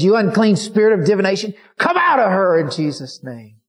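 loud, high commanding rebuke. Retold or imitated. Preacher rebuking the unclean spirit, loud and commanding. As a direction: cast the spirit out with full force.

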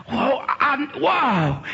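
high, broken-off exclamations; acted overwhelmed cry. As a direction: reel in mock astonishment. Acts out woman overwhelmed.

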